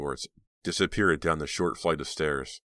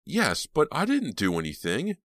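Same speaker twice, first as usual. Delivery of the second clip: raised frantic protest; exclaiming; 10 semitones higher, 4 dB louder than usual. A narrator's reading. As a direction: insist you're innocent. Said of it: "Reva heard Rob exclaim".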